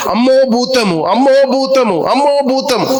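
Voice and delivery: frightened disciples shrieking at a ghost; terrified cry. Acted. Disciples screaming in fear.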